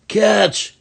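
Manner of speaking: high, loud call across the playground; yelled. Acted. Boy yells to another boy, high and loud. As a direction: shout it to a friend. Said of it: "he yelled to another boy".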